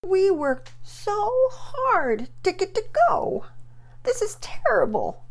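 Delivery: put-on high, slow child's whine; whining. Sick girl complaining the trip is ruined, high slow whine. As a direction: stretch it out like a sulking little girl. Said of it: "Penelope whined".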